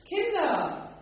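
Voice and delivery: high, loud call across the water. A narrator's reading. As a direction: call out to them from the shore. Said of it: "rief er ihnen zu". (laut und hoch gerufen)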